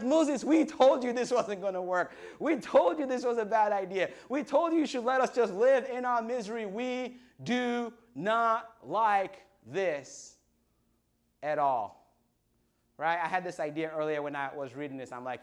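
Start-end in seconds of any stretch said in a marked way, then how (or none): 0.0-12.0 high-pitched, frantic mock complaint; hammed-up panicky whining at a leader; freaking-out Israelites. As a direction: pile up the protests, then drag out the last words. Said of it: "they're freaking out. They're like".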